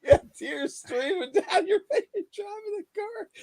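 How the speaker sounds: laughing through tears while telling it. Real, not acted. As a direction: giggle through the story of the car ride. Laughing recounting crying together.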